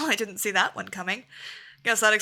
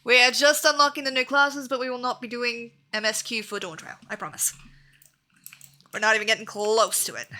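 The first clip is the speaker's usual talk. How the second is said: ordinary talk, nothing marked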